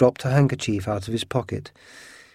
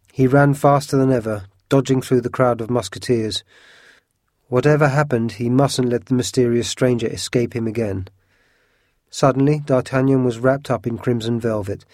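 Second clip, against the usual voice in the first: ordinary delivery, nothing marked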